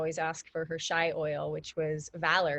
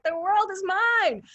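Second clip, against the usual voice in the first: slow, high and loud, on top of the world; triumphant declaration; 12 semitones higher, 7 dB louder than usual. Retold or imitated. Reliving the high after the session, a slow triumphant cry. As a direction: proclaim it like you own everything. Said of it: "You know, like".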